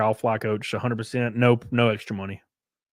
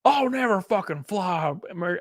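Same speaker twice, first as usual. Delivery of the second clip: mock outraged rant, pitched up; higher, put-on angry voice; angry customer; 6 semitones higher than usual. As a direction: imitate a furious traveler swearing off the airline. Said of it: "why he was so angry".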